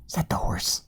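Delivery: soft whispered question; whispered aside. Pretend. Reader points out the horse in the stable, whispering. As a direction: drop to a whisper for the listener.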